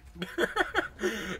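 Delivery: high, loud burst of giggles; quick cackling laugh. Real, not acted. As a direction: laugh nervously at the monster. Laughs at alien in game.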